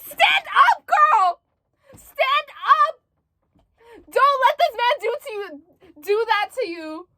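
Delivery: put-on shouting "stand up" to a fictional girl; screaming at the character. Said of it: "sorry for screaming".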